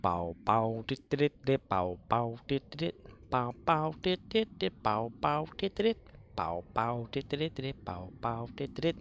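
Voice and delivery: rhythmic bow and dit noises; vocal sound effects. Pretend. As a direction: make the laser sounds with your mouth. Mouth-made blaster sounds.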